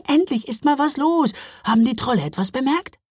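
high, quick elf voices, eager; excited little elves. A narrator's reading. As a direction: chirp it out like excited tiny elves. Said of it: "riefen sie außer Atem".

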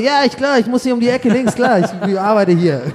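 hammed-up busy phone answer; fake phone call. Comedian faking a call to look like he belongs, fake phone call. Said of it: "dass ich so rangehe".

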